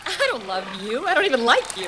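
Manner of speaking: loud, wavering protest; rising, agitated outburst; quivering denial. Acted. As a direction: deny it hotly, voice quivering. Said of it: "why is your voice quivering".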